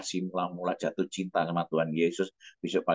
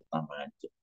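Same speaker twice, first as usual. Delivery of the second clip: "tambah hancur" sinking lower and much softer, almost gone; fading away; 5 semitones lower, 10 dB quieter than usual. Shown as a demonstration. Despairing man recalling his ruined marriage, trailing off softly. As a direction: let "tambah hancur" die away to nearly nothing. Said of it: "wah tambah hancur".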